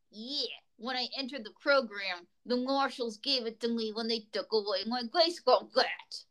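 put-on puppet mobster with a New York accent; deadpan New York ex-mobster magician. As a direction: play a New York mobster in witness protection. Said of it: "I'll give him a New York accent".

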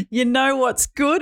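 broad Australian drawl, slow and high; Aussie accent. Retold or imitated. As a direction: lay on a full Aussie accent. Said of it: "in a full Aussie accent".

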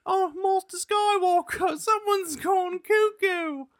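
put-on very high, small child voice. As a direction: pipe up like a little Jedi kid. Impersonating a Star Wars youngling.

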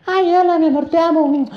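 put-on high sweet girlfriend voice; a hammed-up falsetto girlfriend. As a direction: coo it like a doting girlfriend. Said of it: "Soy tu novia".

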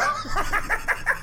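breathless high-pitched cackle; high wheezing laugh. Real, not acted. Friend cracking up at the story, high breathless wheeze.

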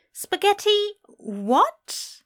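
high, drawn-out startled question; taken aback. Acted. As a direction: balk at it in a high, slow voice. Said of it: "Aziraphale was taken aback by this".

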